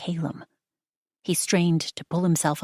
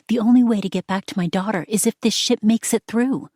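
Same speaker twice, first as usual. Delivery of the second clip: intense; 6 semitones higher, 6 dB louder than usual. A narrator's reading. Celia's inner resolve.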